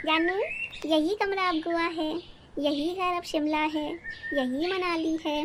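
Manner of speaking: slow, drawn-out mock of a stingy husband; lazy husband. Wife mimics husband after marriage, slow and mocking. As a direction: stretch each line out lazily, mocking him. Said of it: "और शादी के बाद".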